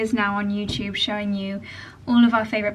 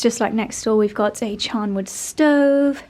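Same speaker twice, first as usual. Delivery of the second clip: normal talking voice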